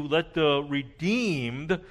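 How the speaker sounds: redeemed drawn out slowly with weight; slow, stressed redeemed. Shown as a demonstration. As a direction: lean hard on redeemed, slow. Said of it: "the way I emphasize it".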